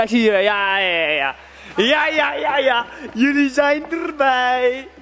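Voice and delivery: ordinary delivery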